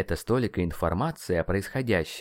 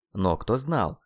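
plain reading voice

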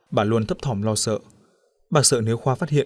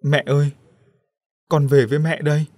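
lightly voiced ghost calling; faint distant voice; 4 semitones higher, 4 dB louder than usual. A narrator's reading. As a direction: call to mother as a spirit from afar. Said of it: "Một giọng nói yếu ớt từ xa vọng tới".

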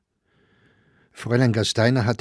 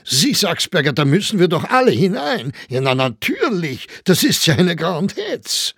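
higher, louder teasing Viennese crony; teasing Grossinger; 9 semitones higher, 5 dB louder than usual. A narrator's reading. Grossinger teases Saxberger about his absence, mock-jovial. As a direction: needle him with a knowing laugh.

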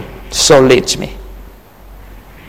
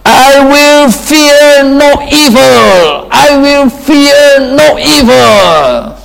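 slow, high, loud proclamation; crying out; 11 semitones higher, 4 dB louder, slower than usual. Retold or imitated. Preacher voicing David crying out to his congregation. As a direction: call it out like David in the valley. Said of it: "David cried out".

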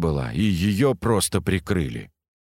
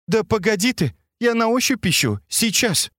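put-on much higher, fussed young woman; impatient girl; 12 semitones higher than usual. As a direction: brush him off while searching. Katya fumbling, voiced higher.

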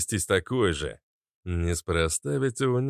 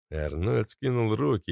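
ordinary delivery, nothing marked

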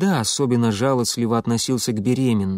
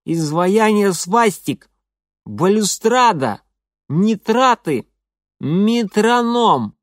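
loud, high, slow gurgled words; gurgling; 6 semitones higher, 4 dB louder, slower than usual. A narrator's reading. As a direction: shout them through a mouthful of teeth. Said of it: "полон рот этих битых зубов".

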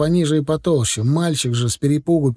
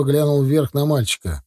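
ordinary delivery, nothing marked

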